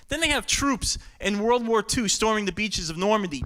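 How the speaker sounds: ordinary talk, nothing marked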